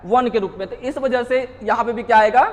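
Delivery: usual speaking voice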